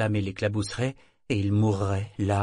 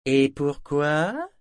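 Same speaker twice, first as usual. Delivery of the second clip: slow, drawn-out and higher, teasing; mysterious tone; 6 semitones higher, 6 dB louder, much slower than usual. A narrator's reading. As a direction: let the question hang mysteriously. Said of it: "demanda Mingsley d'un ton mystérieux".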